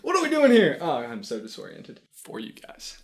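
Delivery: ordinary talk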